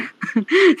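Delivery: brief self-conscious laugh; short laugh. Real, not acted. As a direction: laugh briefly at your own singing. Laughs at her own singing.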